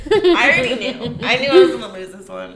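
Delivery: loud laughing through the words. Real, not acted. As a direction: laugh it off while talking. Losing the game, laughing.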